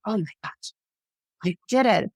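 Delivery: a mild mock tremble, just got through it; shaky relief. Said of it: "shaking and just like".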